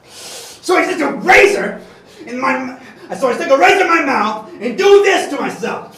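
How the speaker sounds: loud, cackling and fast; manic laughing shout. Acted. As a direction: laugh it out, unhinged. Manic villain confession.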